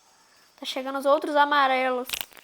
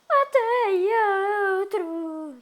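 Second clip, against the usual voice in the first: triumphant cheer; 8 semitones higher, 4 dB louder, much slower than usual. Real, not acted. Triumphant high.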